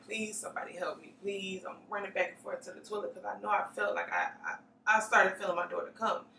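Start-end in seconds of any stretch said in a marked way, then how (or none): 0.0-1.6 breathless whispered begging; whispered plea. Retold or imitated. Retelling her plea from the cell floor, whispered. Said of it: "screaming, crying, like".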